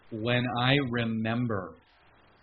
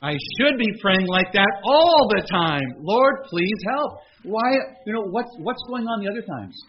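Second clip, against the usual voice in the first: realizing plea; 9 semitones higher, 10 dB louder than usual. Retold or imitated. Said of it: "the light bulb came on and I thought".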